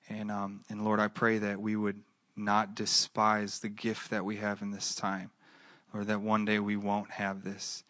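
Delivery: soft, unhurried pastoral prayer; closing prayer. Real, not acted. Pastor praying for the congregation after the sermon, gently. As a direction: pray over the congregation gently.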